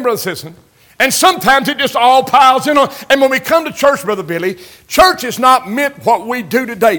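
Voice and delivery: loud, high, driving sermon cadence; preaching at full force. Acted. Preacher exhorting his congregation, preaching at full force. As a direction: hammer home each line to the pews.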